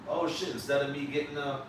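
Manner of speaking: ordinary talk, nothing marked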